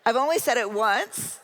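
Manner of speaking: normal talking voice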